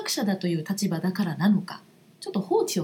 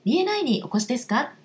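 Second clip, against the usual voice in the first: cheerful, high greeting at the door; bright welcoming voice; 8 semitones higher, faster than usual. Shown as a demonstration. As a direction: greet a visitor brightly and warmly. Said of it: "そして明るい声で".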